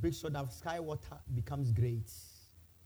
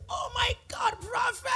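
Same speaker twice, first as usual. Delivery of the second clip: high, excited stage-whispered cry; excited greeting; 4 dB louder, slower than usual. Retold or imitated. Church member spotting the prophet on the flight, excited cry. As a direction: gasp it out, thrilled to see the prophet.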